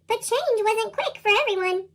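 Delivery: ordinary delivery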